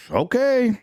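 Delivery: high, hesitant agreeing okay; reluctant okay. Retold or imitated. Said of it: "So Victor's like".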